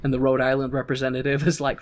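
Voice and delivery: ordinary talk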